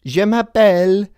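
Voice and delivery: hammed-up fake French accent, high and loud; a clumsy put-on French accent, reciting school phrases; bad French accent. Showing off his middle school French, in a bad French accent. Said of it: "That's not really a French accent".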